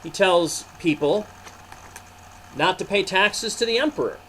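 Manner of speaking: lively read-aloud storytelling; story narration. A narrator's reading. Narrator reading a Bible story to boys and girls, lively. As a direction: tell it to the children, animated.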